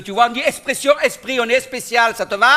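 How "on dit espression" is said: "expression" said as "espression", no k sound; southern-style "expression" with plain s for X; regional pronunciation without X. Shown as a demonstration. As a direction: drop the X in "expression": es-pression. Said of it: "chez nous, il n'y a pas d'isque".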